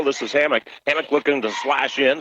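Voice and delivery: ordinary talk, nothing marked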